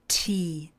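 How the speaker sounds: Tea with the T pushed out as a breathy puff; aspirated T. Shown as a demonstration. Teacher repeats Tea for learners, airy aspirated T. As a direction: let the T of Tea hiss with air. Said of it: "Pozor na přídech u hlásky T".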